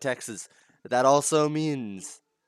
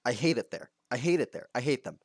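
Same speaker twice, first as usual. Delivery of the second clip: normal talking voice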